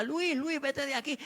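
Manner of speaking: high, slow ghostly warning; lightly voiced old woman pleading him to leave; a hint of a trembling old woman; old woman's warning. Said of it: "y me decía".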